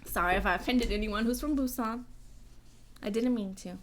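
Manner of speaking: ordinary talk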